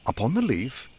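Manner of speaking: plain reading voice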